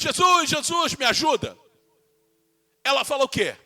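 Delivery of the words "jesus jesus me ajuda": cry for help. Retold or imitated. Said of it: "Ela grita apenas".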